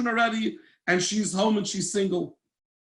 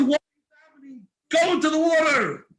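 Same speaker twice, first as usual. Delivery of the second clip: voice of Hashem; 8 semitones higher than usual. Acted. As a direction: command them into the sea. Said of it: "Hashem said".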